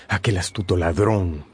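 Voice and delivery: normal talking voice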